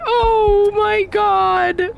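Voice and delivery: very high, drawn-out cry of relief; overjoyed surprise. Real, not acted. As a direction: stretch it out in delighted disbelief. Finally figures out the puzzle.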